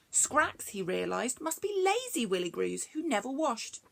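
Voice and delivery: ordinary delivery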